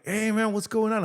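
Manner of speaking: put-on high, bright burst of surprise at an old friend; excited greeting. Greeting a hometown buddy at the store, excited. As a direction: greet him like a long-lost buddy. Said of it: "I'm super excited".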